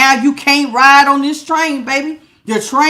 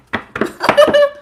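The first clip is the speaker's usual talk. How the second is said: high laugh. Real, not acted.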